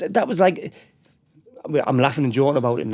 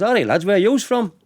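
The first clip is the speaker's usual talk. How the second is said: lightly voiced, higher-pitched friendly landlord; pub landlord greeting; 8 semitones higher, 3 dB louder than usual. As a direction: imitate a pub owner hailing the lads. Acting friendly landlord greeting strangers.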